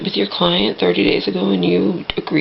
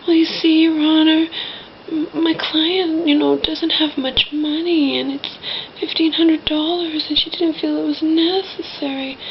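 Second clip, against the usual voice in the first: mock whiny lawyer, pitched up; whining; 6 semitones higher than usual. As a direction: whine it at the judge, almost pleading. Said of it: "whining almost".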